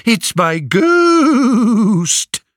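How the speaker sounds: high, slow ghostly mock hoot; a drunken suitor mocking the prophet. A narrator's reading. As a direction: play a spooky ghost to jeer.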